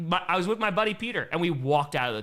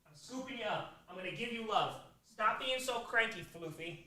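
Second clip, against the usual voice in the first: soft, tender cooing; tender pet talk; 4 semitones higher, 10 dB quieter than usual. Real, not acted. Owner soothing cranky cat while picking her up, soft coo.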